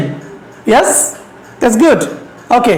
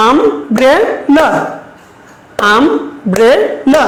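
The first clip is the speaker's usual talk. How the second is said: Umbrella chopped into um, bre, la; a pause after each syllable of Umbrella, as if clapping it out; much slower than usual. Shown as a demonstration. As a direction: say Umbrella very slowly in three separate beats. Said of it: "ক্লেপ দিয়ে সিলেবলটা বলো".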